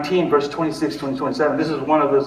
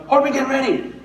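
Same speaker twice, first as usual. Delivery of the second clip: retold yell; 11 semitones higher than usual. Aunt hurrying her son to get ready, mock yelling. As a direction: imitate an aunt calling out, pitched way up. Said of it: "I will yell at him".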